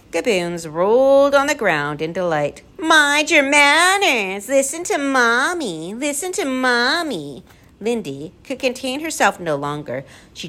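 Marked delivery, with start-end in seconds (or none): 2.8-7.4 high, drawn-out sing-song; mocking mimicry. A narrator's reading. Gaboons rolling in delight, mimicking the bird mockingly. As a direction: parrot it back in a teasing creature voice.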